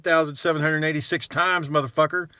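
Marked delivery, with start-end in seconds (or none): none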